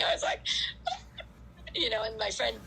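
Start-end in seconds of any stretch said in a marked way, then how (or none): 0.4-1.2 high-pitched imitated wail; mock crying. Runner re-enacting her breakdown at the drop-off, mock crying. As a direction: squeal out a pretend sob. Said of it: "I just started crying".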